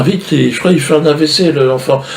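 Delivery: fairy calling the doctor in fake panic; mock panic. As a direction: call out in hammed-up alarm. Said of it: "Elle appelle le médecin".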